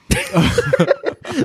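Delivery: laugh. Real, not acted. Laugh over odd name.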